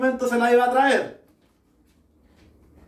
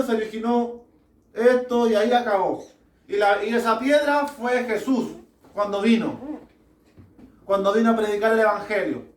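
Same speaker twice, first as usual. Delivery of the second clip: usual speaking voice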